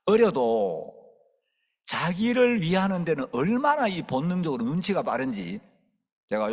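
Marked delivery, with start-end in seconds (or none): none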